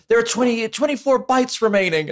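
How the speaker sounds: high, put-on female voice reading bytes; squeaky imitation of a scared typist; mock Nancy. Podcaster mimicking Nancy at the bomb computer, high put-on voice.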